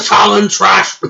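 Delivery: loud taunt coughed out, slowly; mock cough hurling a rival taunt; jab disguised as a cough. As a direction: hide an insult inside a fake cough. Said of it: "I am so sorry for the coughing".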